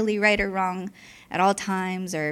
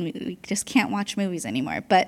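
ordinary talk

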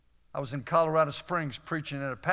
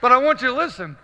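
loud, high call for attention; emphatic preaching; 9 semitones higher, 10 dB louder, faster than usual. Real, not acted. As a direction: raise your voice to the whole congregation. Preacher drives home his point.

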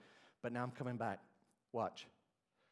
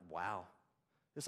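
ordinary talk

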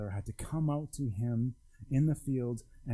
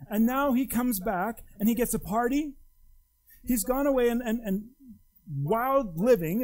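indignant complaint; 7 semitones higher, 5 dB louder than usual. Acted. Older brother's complaint to father.

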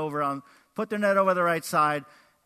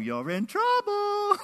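very high, drawn-out sing-song taunt; mock-ominous high croon; teasing warning; 3 dB louder, slower than usual. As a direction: stretch it out like a playground tease.

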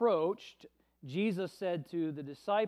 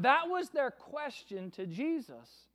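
ordinary delivery

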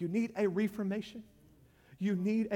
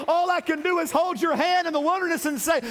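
high, loud, rhythmic preacher cadence; full-force preaching; 9 semitones higher, 6 dB louder than usual. Acted. Preacher urging the church through the wilderness, loud and high. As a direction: whoop it up over the music, much louder.

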